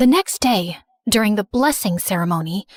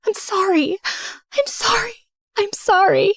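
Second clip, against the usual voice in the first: much higher, pleading sorry; crying apology; 10 semitones higher than usual. Acted. Rose begging Jessica, higher and tearful. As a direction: beg forgiveness through tears. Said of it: "I was crying hard".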